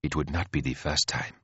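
low, softly spoken queen; mild queen. Acted. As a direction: say it mildly, keeping the voice down. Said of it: "says Mastiat mildly".

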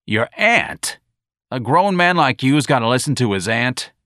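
loud, scornful disbelief; mocking disbelief. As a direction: sneer at his excuse. Sergeant mocks.

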